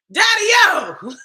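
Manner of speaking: high, loud teasing greeting; teasing call. Real, not acted. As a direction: hail him mockingly. Teasing the commenter.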